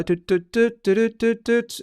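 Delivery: little high-pitched sung doots; doot-doot humming filler. Real, not acted. Host thinking what else to say, doot-doot filler. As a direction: toot out a rhythmic string of doots. Fills time while thinking.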